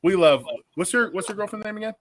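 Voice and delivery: ordinary talk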